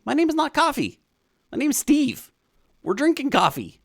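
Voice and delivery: high-pitched comic indignation; squeaky-high mock objection; mock protest. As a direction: pitch it way up, playfully correcting someone. Mock protest at being called Coffee.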